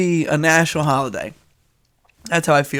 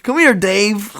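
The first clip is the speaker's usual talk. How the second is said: mock dog-owner call; 4 semitones higher than usual. Mock owner calling dog by name.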